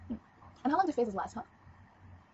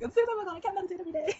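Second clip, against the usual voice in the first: whiny self quote; 11 semitones higher, 5 dB louder, faster than usual. Retold or imitated. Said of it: "I was like".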